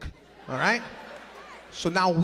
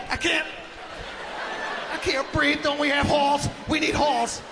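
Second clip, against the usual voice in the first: put-on pleading kid; 7 semitones higher than usual. Kid pleading under towel.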